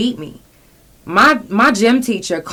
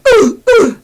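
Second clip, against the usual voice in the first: sobbing gasp; 11 dB louder than usual. Real, not acted. Crying breath while retelling trauma.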